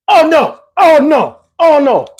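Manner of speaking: mock him wailing in protest; mocking dismay. Mimicking the man's dismay.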